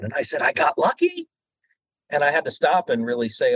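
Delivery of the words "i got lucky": high rising disbelieving question; can't believe the news; stunned disbelief. Retold or imitated. Hearing he booked Lucky, stunned rising question.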